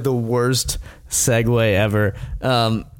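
ordinary delivery

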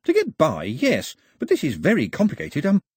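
a chatterbox who can't stop telling it; eager chatty Pilu. Acted. Pilu hedging.

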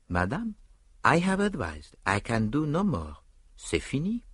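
put-on French accent. A narrator's reading. Poirot voiced, resigned French detective.

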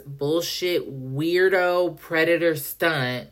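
slow, drawn-out disbelief; incredulous. Retold or imitated. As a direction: spell out the outrage word by word, very slowly. Confronts the guard in disbelief.

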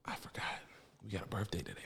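whispered, under the breath; whispered aside. Real, not acted. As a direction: whisper it like a confession. Aside after stopping the intro.

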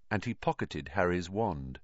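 ordinary delivery

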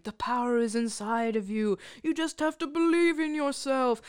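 solemn, echo-like mentor voiceover; dramatic words of encouragement; flashback voiceover. Retold or imitated. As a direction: intone it like a movie voice in someone's head. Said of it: "her grandmother and mother talking to her".

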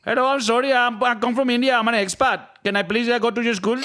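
mock Indian accent. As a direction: plead like an Indian newcomer asking for school. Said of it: "that's not an expat".

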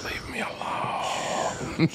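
breathy, drawn-out whisper; whispered plea. Real, not acted. Friend on shrooms asking to be left alone, whispering. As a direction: beg softly to be left alone.

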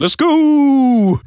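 high, loud hyped-up shout. Pretend. Mates joking about Van Gogh, a high loud hyped shout. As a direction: belt it out like a pumped-up cheer. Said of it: "He goes, he goes".

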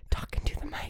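hushed, voiceless whisper. Retold or imitated. Retelling how they whispered to a colleague during the call, whispered. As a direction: re-enact a whispered instruction. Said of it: "And then I whispered to him".

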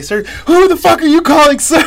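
mock furious drill sergeant shout; drill sergeant yell. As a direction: bark it in outrage at the recruit. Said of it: "another drill sergeant that heard me say".

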